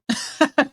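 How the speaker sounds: high, loud burst of laughter; sudden high-pitched laugh; amused laugh. Acted. Amused by the question about Juice.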